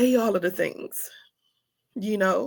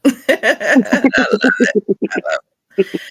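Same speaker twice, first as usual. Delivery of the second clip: ordinary delivery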